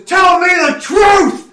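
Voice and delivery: slow, shouted furious accusation; Titania's furious shout. Acted. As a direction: scream the demand at a lying husband. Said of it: "you hear her voice go".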